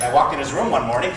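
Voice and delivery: ordinary delivery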